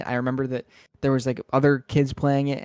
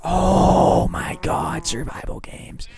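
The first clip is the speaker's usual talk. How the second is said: drawn-out exclamation; 5 semitones higher, 5 dB louder, much slower than usual. Real, not acted. Remembering embarrassing old videos.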